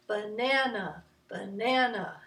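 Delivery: 'banana' with a push on the middle syllable; ba-NA-na in steady rhythm; middle-syllable emphasis. Shown as a demonstration. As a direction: lean on the second syllable of 'banana'. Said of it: "it has a little bit of emphasis".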